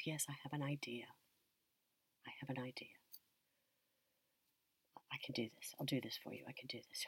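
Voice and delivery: soft, whispered, steady promise; calm her down under your breath; hushed reassurance. Retold or imitated. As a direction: grandchild quietly reassuring a frightened grandmother. Reassures grandma quietly at the table.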